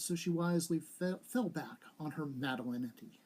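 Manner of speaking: ordinary delivery, nothing marked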